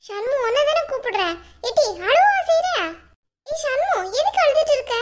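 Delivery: high-pitched, swooping questions; alarmed concern. Acted. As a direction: press her urgently, startled by the tears. Alarmed at finding her crying.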